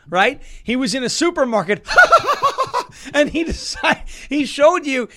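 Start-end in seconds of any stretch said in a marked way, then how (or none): 1.9-3.1 high, loud laugh; burst of laughter. Real, not acted. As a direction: break into real laughter. Laughs at Tucker grocery story.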